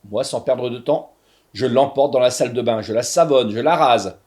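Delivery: plain reading voice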